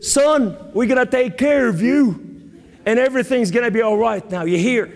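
put-on Southern accent, pitched well up. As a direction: do a reassuring Southern good ol' boy. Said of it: "he spoke with a southern accent".

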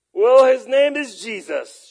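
loud blurt. Retold or imitated. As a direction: declare it loudly and slowly. Retells blurting out the name.

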